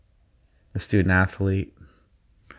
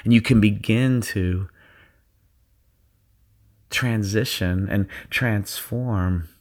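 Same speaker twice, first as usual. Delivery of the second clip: guided meditation. Acted. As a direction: ease them into their zone. Leads a guided visualization.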